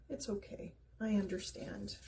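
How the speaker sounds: a tender murmur to the skunk; gentle. Acted. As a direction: hush it down kindly, forgiving. Dex gently hugs the skunk.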